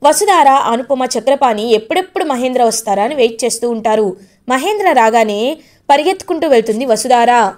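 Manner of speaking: ordinary delivery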